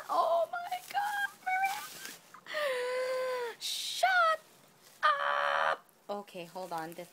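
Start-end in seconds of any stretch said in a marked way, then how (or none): 0.1-5.8 high, loud overjoyed shriek; excited squeal. Real, not acted. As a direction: shriek with disbelieving joy. Overwhelmed opening a gift.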